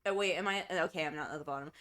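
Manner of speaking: usual speaking voice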